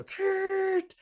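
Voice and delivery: very high squealing tire noise; tire screech. Retold or imitated. Peeling out and leaving her behind, screeching tires. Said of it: "I still hear the wheels".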